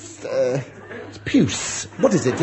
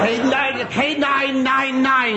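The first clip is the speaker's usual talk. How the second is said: slow, high, savoured; milk K nine nine nine; drawn-out punchline; 9 semitones higher, slower than usual. Acted. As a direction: stretch the joke out. Punchline dragged out.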